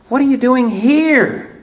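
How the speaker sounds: "here" held long at the end, high; stress on here. Shown as a demonstration. Preacher demonstrating God's question, stress on "here". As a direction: save the weight for "here". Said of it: "accent the others as you read the question".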